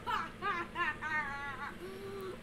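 long cackling hyena laughter; drawn-out cackle. Real, not acted. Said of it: "my hyena laugh".

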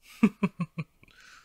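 loud, high gloating villain laugh; mocking laugh. Villain amused by defiance.

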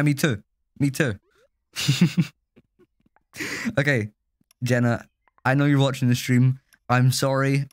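ordinary talk, nothing marked

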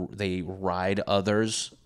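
ordinary talk

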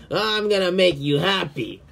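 normal talking voice

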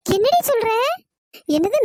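shocked. Acted. Shocked at dream accusation.

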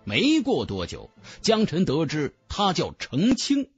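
usual speaking voice

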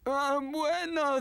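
ordinary talk, nothing marked

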